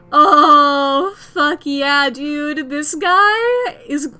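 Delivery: loud, slow hyped cheering; excited gushing. Real, not acted. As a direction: cheer them on like a fan. Streamer praises the teens.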